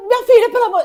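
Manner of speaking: very high, loud panicked cry; hammed-up maternal alarm; alarmed mother. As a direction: imitate a mother woken in a fright. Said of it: "ia acordar super assustada".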